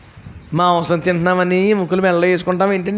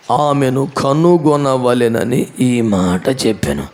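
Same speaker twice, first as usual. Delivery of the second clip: solemn Jesus; 7 semitones lower, much slower than usual. Retold or imitated. Quoting Jesus' words solemnly.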